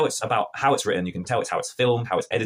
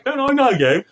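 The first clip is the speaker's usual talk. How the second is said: mock guard challenge; 13 semitones higher, 7 dB louder, slower than usual. Jocks taunting Robin in forest.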